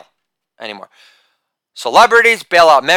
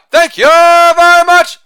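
much higher, slow, loud showman's thank-you; put-on catchphrase voice; 12 semitones higher, 6 dB louder, slower than usual. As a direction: stretch it out like a lounge singer milking applause. Repeated catchphrase after joke.

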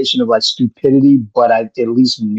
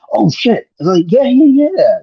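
much higher and louder, an excited squeal; retold sudden realization, voice jumping up; mock-excited yeah yeah yeah; surprised delight; 12 semitones higher, 4 dB louder than usual. Surprised bartender recognizes his shirt.

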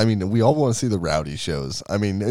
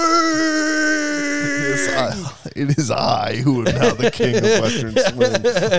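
crown yourself king with glee; megalomaniac rant; 13 semitones higher, much slower than usual. Pretend. Mock crowning as king of swing.

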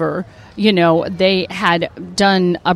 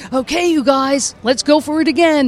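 rally the gang like a camp counselor; cheerful rally; 6 semitones higher than usual. Retold or imitated. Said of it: "and going".

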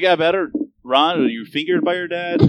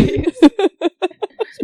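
slow, laughing, higher joke; 13 semitones higher than usual. Real, not acted. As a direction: draw it out while laughing. Joking about dad named Guac.

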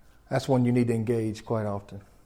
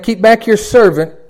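loud, high, slow, deliberate; drawn-out pulpit proclamation; proclaimed scripture; 8 semitones higher, 11 dB louder than usual. Acted. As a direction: repeat it slowly with weight. Repeats the psalm slower.

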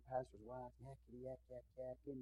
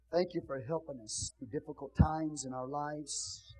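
closing prayer; 2 semitones higher, 7 dB louder than usual. Acted. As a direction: lift the congregation to God in prayer. Leads the church in prayer.

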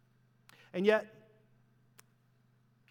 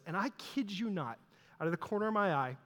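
normal talking voice

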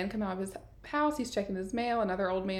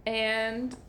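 normal talking voice

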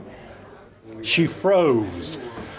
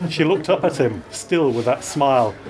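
ordinary delivery, nothing marked

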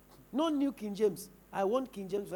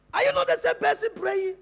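rebuking; 8 semitones higher, 8 dB louder than usual. Real, not acted. Preacher rebuking the congregation.

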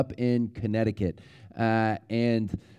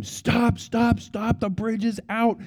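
retold yelled warning; 9 semitones higher, slower than usual. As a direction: call out an urgent warning without full volume. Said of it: "he's yelling at them".

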